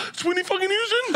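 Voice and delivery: very high squealed disbelief; mock starstruck shock. Dad recognizes the celebrity who comforted him, squealing mock shock. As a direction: squeal it in hammed-up astonishment.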